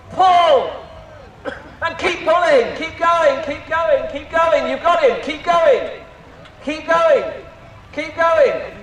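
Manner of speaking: high excited urging, keep going; tug of war. Real, not acted. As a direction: cheer the rope team to the end. Leading crowd tug of war.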